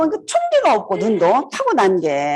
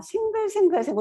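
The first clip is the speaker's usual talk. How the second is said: smiley sing-song; 10 semitones higher than usual. Shown as a demonstration. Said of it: "웃는 얼굴이잖아".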